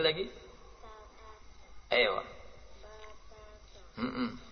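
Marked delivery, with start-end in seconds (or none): none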